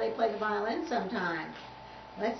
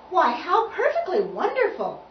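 plain reading voice

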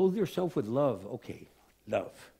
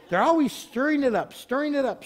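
usual speaking voice

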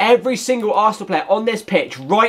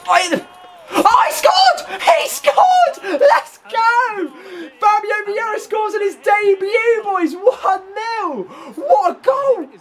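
real euphoric yelling at a goal; ecstatic goal shout; slower than usual. Real, not acted. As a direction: scream it like your player just scored on debut. Celebrating a debut goal.

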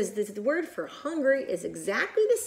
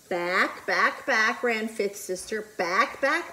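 plain reading voice

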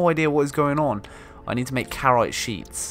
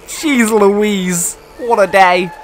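excited; 7 semitones higher, 10 dB louder, much slower than usual. Real, not acted. Overwhelmed exclamation.

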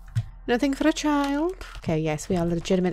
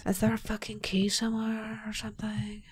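frustrated whisper; 10 dB quieter than usual. Real, not acted. Stuck streamer whispering while hunting for a key. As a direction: hiss the question under her breath. Searching a locked room.

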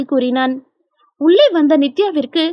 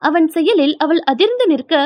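plain reading voice

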